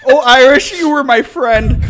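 loud, high wail of betrayal through laughter; hammed-up laughing lament; mock lament. Mock lament to whiskey that burns.

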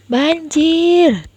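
high alarmed shout of flood. Acted. Big sister shouting warning as flood arrives, alarmed. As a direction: cry out in sudden alarm. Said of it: "teriak Kak Nilam".

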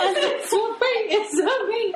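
giggling through the words; laughing while repeating the joke. Real, not acted. Echoing partner's joke while laughing.

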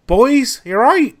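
mock cheery boss calling out; loud cheery manager. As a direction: call out to the boys brightly from the door. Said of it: "Sharon walks in and goes".

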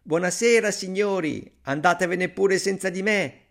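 high, cheeky farewell call. A narrator's reading. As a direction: shout goodbye to men left behind. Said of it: "gridò loro".

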